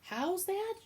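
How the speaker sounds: high loud shout; shouted question. Acted. Midge shouting back at Cook, high and loud. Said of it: "shouted Midge".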